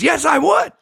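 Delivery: high, eager yes; husband agreeing. Retold or imitated. Husband accepting the offer, high. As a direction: jump at the offer. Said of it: "He goes".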